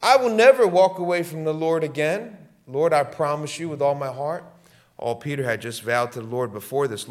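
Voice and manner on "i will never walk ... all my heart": raised, earnest vow of devotion; loud vow. Retold or imitated. Preacher voicing Peter's vow to the Lord, loud and earnest. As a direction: declare it loud like a swearing disciple.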